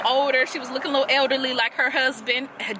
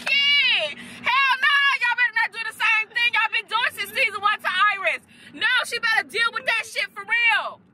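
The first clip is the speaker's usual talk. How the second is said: real heated rant, pitched up high; letting it all out at the writers; fed-up ranting about the show; 9 semitones higher than usual. Real, not acted. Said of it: "I just went on a whole fucking rant".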